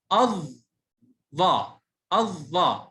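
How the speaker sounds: الظا split as أظ then ظا, then joined; doubled consonant. Shown as a demonstration. Teacher breaking down the shadda, الظا with doubled ظ. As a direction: sound the ظ twice in الظا, the lam silent. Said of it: "يبقى الظاء تنطق مرتين".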